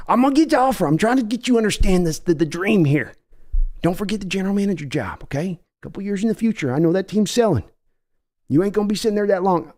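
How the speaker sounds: folksy Texan owner voice, pushy; brash Texas accent of a team owner; Jerry Jones impression. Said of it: "That was me, Jerry Jones".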